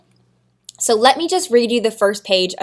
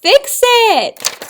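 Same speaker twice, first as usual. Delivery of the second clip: upbeat fix it; 11 semitones higher, 5 dB louder than usual. Shown as a demonstration. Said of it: "that's right".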